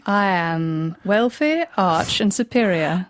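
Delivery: slow, superior British accent. Shown as a demonstration. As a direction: savour each word like a wealthy Brit. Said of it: "So let's try this".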